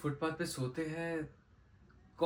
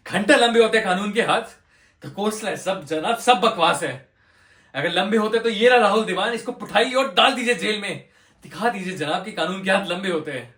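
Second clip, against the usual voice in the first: loud, high, scornful shouting; furious outburst; 7 semitones higher, 12 dB louder than usual. Acted. Lawyer demanding the judge jail Rahul Dewan, furious. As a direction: challenge the court at full force.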